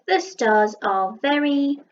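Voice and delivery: drawn out, word by word; slow pointing read; slow reread. Shown as a demonstration. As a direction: read it back slowly to check. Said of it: "Um, very".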